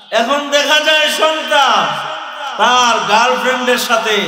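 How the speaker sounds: preaching at full force. Acted. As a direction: rouse the crowd at the top of the voice. Sermon climax.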